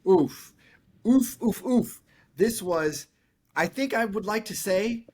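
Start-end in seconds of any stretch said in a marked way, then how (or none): 0.1-2.0 rising oof. Real, not acted. Reacting to a scary movie.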